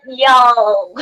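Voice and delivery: loud mock Southern "Y'all", falling; a British woman belting a Southern "y'all" for fun; hammed-up Southern y'all. British guest shouting a mock Southern y'all. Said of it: "I'm like".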